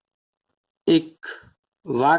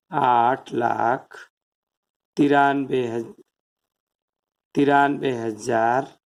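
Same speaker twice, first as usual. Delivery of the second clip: slow dictation; 5 semitones lower, slower than usual. Shown as a demonstration. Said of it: "तो इसको लिख लेंगे शब्दों में".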